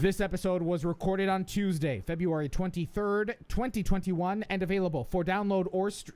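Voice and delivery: polished announcer delivery; podcast intro read. Real, not acted. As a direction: announce the show to the listeners. Reads the show's intro.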